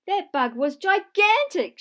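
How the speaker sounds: loud, high excited shout. Acted. Max shouting about the huge bug. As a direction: shout it out in amazement. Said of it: "shouted Max".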